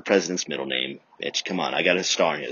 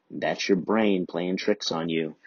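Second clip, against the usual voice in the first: ordinary talk, nothing marked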